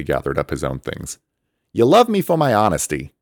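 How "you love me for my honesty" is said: high, loud cheeky call; loud teasing call; calling over shoulder. A narrator's reading. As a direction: shout it back while flying off. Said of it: "he called over his shoulder".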